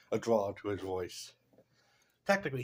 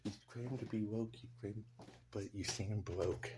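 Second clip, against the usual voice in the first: much softer, almost under the breath; hushed repeat; 12 dB quieter than usual. Pretend. Repeating his own roast to himself, softly. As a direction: say it again quietly to yourself. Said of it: "Oh, sorry".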